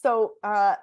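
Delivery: normal talking voice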